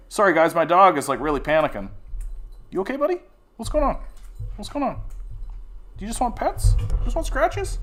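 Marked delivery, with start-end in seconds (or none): 2.7-7.8 much higher, sing-song pet talk; talking to the dog. Real, not acted. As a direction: coo at a worried dog. Talking to his panicking dog.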